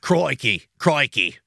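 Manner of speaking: "Crikey" as "croy-key", Australian accent. Shown as a demonstration. Host asked to do a phrase, tries an Australian accent on "Crikey". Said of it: "Well, do a phrase then".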